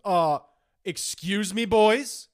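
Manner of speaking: loud, halting mock reprimand; officious student official. Student association member ordering boys to stop smoking, loudly officious. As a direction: pause hard between phrases like a self-important authority.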